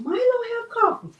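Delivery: high amused quoting; mock viewer question. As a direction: imitate the viewers asking. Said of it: "They were saying".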